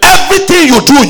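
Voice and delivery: ordinary talk, nothing marked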